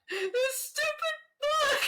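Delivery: high, slow, tearful wail over a book; half-laughing fake sob, drawn out; mock sobbing wail. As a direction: whimper it like a hammed-up crybaby. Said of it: "and I'm like".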